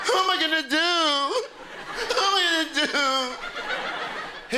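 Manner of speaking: high, wailing fake sob; mock despair. Youngster bullied by text, high mock wailing. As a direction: whine helplessly, dragging out the last word.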